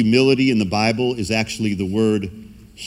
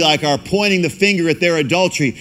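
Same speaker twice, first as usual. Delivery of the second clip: normal talking voice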